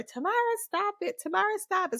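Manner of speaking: high, quick mock nagging; nagging older sister. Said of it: "So then she got fed up".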